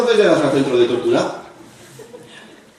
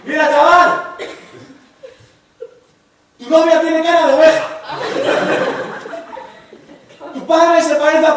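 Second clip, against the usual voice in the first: mock angry rant; 12 semitones higher than usual. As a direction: fire off the insults, fed up. Se me hinchó la vena.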